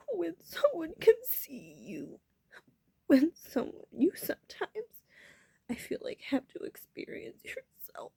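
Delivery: high, breaking into tearful whisper; choked up. Real, not acted. Speaker confessing it's scary to be seen, real choked-up crying.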